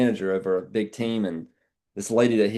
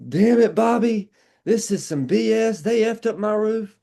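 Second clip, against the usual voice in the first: hammed-up furious lady complaining; imitating angry customer; 12 semitones higher, 4 dB louder than usual. Retold angry customer scolding roofer Bobby, imitated loud rant. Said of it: "he already knew she was pissed".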